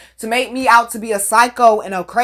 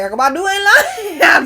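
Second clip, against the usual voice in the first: laughing; 9 semitones higher, 4 dB louder, slower than usual. Real, not acted. Laughing while talking.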